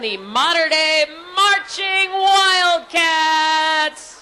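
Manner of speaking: slow, drawn-out stadium announcer; announcer call. Real, not acted. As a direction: stretch it out like a PA announcer. Announcer introduces the band.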